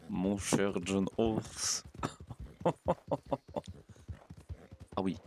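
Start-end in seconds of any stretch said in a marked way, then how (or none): 1.9-3.7 petit rire soufflé. Real, not acted. Rire après la blague au cheval.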